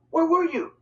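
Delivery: high, loud angry demand; a worried boy scolding his mother; angrily. A narrator's reading. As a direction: confront her with it. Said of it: "I asked angrily".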